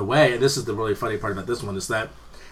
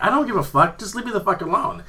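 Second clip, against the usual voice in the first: high-pitched, exasperated comeback; mock-indignant, pitched-up retort; retold angry retort; 9 semitones higher than usual. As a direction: act out brushing off a scolding. Retold quarrel at the crosswalk.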